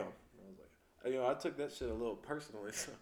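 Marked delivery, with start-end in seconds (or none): none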